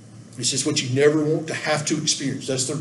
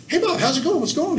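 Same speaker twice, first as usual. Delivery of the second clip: high, breezy hello to a friend; casual greeting; 11 semitones higher than usual. Retold or imitated. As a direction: call out brightly, all innocence. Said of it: "I go".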